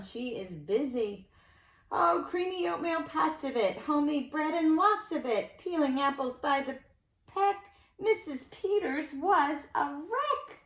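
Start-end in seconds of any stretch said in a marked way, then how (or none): 1.9-10.6 lilting rhymed read-aloud; sing-song storybook telling; story narration. A narrator's reading. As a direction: read it to children with bounce. Reads a rhyming picture book.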